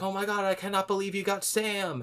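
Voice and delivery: high squeal of happy disbelief; delighted gasp. Retold or imitated. Old coworker on video thrilled that they got Sam. Person on screen excited.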